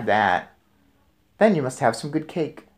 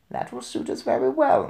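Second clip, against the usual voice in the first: grandmother; 12 semitones higher than usual. A narrator's reading. Grandmother accepts the compromise.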